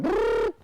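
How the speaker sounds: high buzzing blender imitation; food processor noise. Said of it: "I went".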